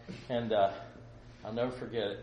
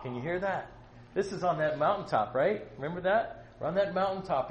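normal talking voice

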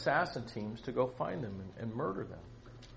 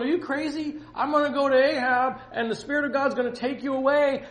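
high, loud, incredulous panic; frantic acted outburst, pitched way up; freaking out; 11 semitones higher, 8 dB louder than usual. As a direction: protest in hammed-up alarm. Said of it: "Obadiah's freaking out".

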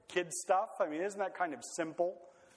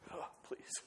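hushed, breath-only scoff; dismissive mock disdain; 14 dB quieter than usual. Super-spiritual believer dismissing the other factions, in a mock-disdainful whisper. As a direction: sigh it out as if above them all.

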